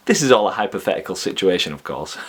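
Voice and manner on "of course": softer, knowing "of course" said low; a hushed, knowing "of course"; knowing wink. Real, not acted. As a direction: drop "of course" like a sly aside. Hypothetical prop story, knowing aside.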